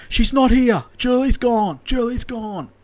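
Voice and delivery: high, urgent imitation of the son; worked-up high phone voice; excited son. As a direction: call it out high, insisting. Joe answers in excited voice.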